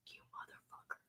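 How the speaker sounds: hissed under the breath; whispered curse. Real, not acted.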